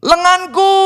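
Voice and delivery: high, drawn-out mock wail; hammed-up wail, last syllable held; mock cry of pain. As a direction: cry out as if the arm is dislocated. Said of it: "Ini kan lagi dislocated".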